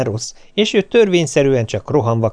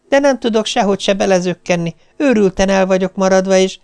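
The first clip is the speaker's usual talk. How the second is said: higher, harried stream of excuses; nervous complaint; 7 semitones higher than usual. Retold or imitated. Wife explains to husband on phone, higher and harried. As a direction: pour out the excuses, frazzled.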